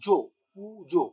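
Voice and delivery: slow Puja with the force on জো; জো pressed, said slowly; stress on jo. Shown as a demonstration. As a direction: lean hard on জো in Puja, slowly. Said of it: "ওই জোতে তুমি force করছো".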